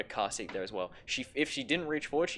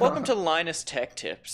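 slow, higher hammed-up host intro; YouTuber intro voice; 5 semitones higher, 5 dB louder than usual. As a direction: announce it like a tech YouTuber.

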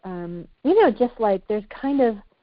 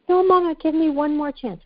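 put-on higher child's voice, begging; pleading little girl; 9 semitones higher than usual. Daughter begging mama for one more chance, high and pleading. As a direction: plead like a small girl losing her treat.

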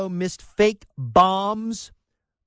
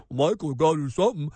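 quick, lower put-on nag; mock caller voice; 4 semitones lower, faster than usual. Host imitating listeners nagging him to act, mock pleading. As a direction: toss it off like a pestering listener.

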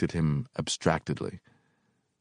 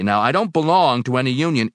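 raised, louder urgent plea; urgent cry; 8 semitones higher, 8 dB louder than usual. A narrator's reading. Helper denying union ties, urgently. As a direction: push it out, desperate to be believed. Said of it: "I cried urgently".

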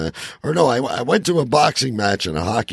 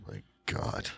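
breathless, unvoiced gasp of disbelief; stunned whisper; 16 dB quieter than usual. Real, not acted. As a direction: barely breathe the words out in shock. Stunned reaction.

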